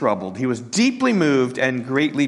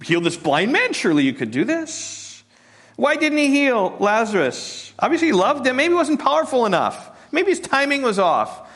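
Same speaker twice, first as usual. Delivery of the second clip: a hint of higher scoffing doubt; doubting skeptics; 9 semitones higher than usual. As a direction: question his power with mild scorn. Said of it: "some skepticism".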